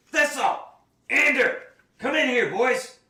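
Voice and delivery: loud, high shout to the back room; calling out. Acted. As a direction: bellow for the boys out back. Shopkeeper calling his helpers.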